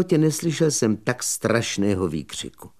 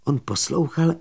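plain reading voice

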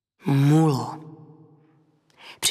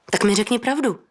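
higher-pitched, urgent plea; insistent; 6 semitones higher than usual. A narrator's reading. Woman pressing Petr for the truth, insistent. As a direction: press for an answer, pitch lifted. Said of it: "naléhala".

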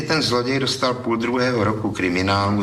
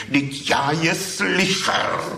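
higher, slow protesting voice; lightly voiced thief, defensive; 11 semitones higher, slower than usual. A narrator's reading. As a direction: defend yourself, drawn out and a little hurt. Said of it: "Bránil se hlas".

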